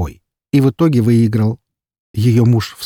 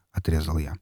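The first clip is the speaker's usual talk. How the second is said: ordinary delivery, nothing marked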